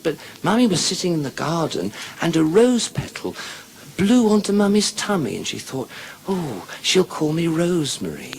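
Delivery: put-on high little-girl storytelling voice; put-on childish treble. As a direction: explain it as a small child, pitched high. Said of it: "She said".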